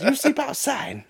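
high, slow mock French order; French kid. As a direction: banish him in a high voice. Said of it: "And he goes".

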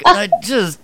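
mocking high squeaky quote; high put-on voice, played for laughs; imitating. Mocking the new Yoda for the group, high and squeaky. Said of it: "You're like".

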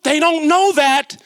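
normal talking voice

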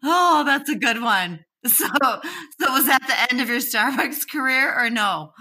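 catching breath after a laugh fit; after laughing hard. Real, not acted. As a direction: still high from laughing. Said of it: "my face hurts from laughing".

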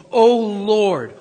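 full-voiced cry of praise; loud proclamation. Preacher cries out God's name.

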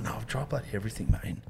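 soft, fast, put-on weary mumble; imitating workmate. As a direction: rattle it off like a defeated mate. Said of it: "He's like".

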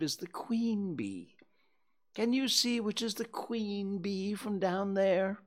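a worried bear dangling from a balloon; Pooh voice. Acted. Pooh anxious about bees.

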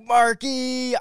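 high, loud mock-thrilled name call; excited greeting. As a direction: imitate someone thrilled to hear from a friend. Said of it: "the way you responded".

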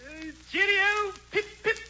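high, slow British cheerio; hammed-up English Santa; English Santa Claus. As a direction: draw out the English farewell. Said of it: "English Santa Claus".

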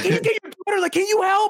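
imitated high, pleading nag; exasperated plea. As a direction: mimic a parent demanding backup. Voicing exasperated mother asking help.

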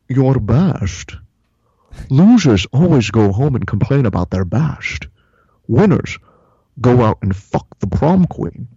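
Scottish movie-star swagger, lazy and smug; Sean Connery impression. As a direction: growl it like Connery schooling a rookie. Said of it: "And Sean Connery responds".

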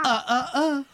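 high, shouted mocking "uh, uh, uh"; Dennis Nedry impression. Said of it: "What does he say?".

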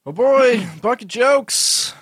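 high, loud hammed-up announcement; excited announcer. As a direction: announce it like a game show host. Announcing favorite segment.

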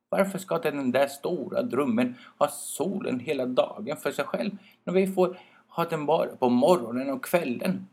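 little trees sulking about the sun; sulky. Acted. Birch brothers complain sulkily about the spruce. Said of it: "tänkte de surt".

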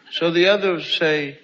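ordinary talk, nothing marked

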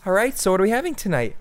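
mock-bright server welcoming a diner; cheery server voice. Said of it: "No Italian accent".

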